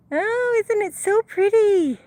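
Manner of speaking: thrilled at the pink sunset; delighted. Real, not acted. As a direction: gush over the pretty sunset.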